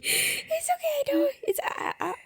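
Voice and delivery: laughing near tears; half laughing, half crying. Real, not acted. Laugh-cry over grief.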